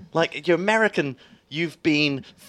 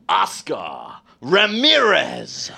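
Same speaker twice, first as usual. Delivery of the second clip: hugely drawn-out, slow movie-voiceover call; announcer voice; 4 dB louder, much slower than usual. Shown as a demonstration. Host's next round of accent duel, slow booming announcer. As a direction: stretch the name out theatrically. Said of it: "we're gonna announce them".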